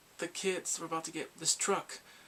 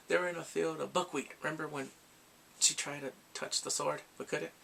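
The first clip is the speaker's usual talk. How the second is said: ordinary delivery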